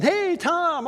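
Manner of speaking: high, loud greeting across the room; cheerful call out. Retold or imitated. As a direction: call out to a friend arriving. Greeting a friend walking in.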